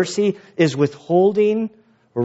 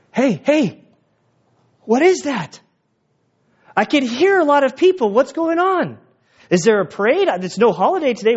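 blind beggar calling; 10 semitones higher than usual. Acted. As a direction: call out like a blind man hearing a crowd. Blind beggar voiced by pastor.

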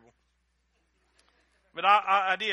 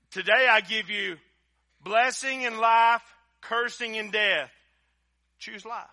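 louder and higher, slowly building the verse; preached out loud; 6 semitones higher, 10 dB louder, slower than usual. Shown as a demonstration. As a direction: proclaim it slowly to the whole church. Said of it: "Somebody help me with that".

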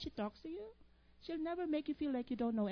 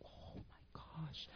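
slow breathless whisper; awed whisper; 8 semitones lower, 8 dB quieter, much slower than usual. Retold or imitated. Reading the professor's notes, stunned whisper. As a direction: hush it out in amazement. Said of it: "I'm like".

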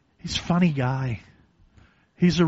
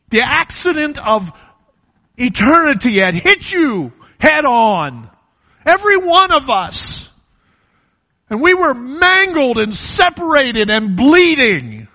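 high, loud impassioned preacher cry; soaring loud sermon voice; preaching at full force; 12 semitones higher, 10 dB louder than usual. Acted. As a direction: lift it into a loud pulpit climax. Preaching climax.

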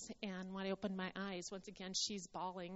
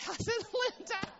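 bursting into a laugh, much higher and louder; 13 semitones higher, 10 dB louder than usual. Real, not acted. Teasing Linda mid-laugh, laughing. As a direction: laugh it out as a playful jab.

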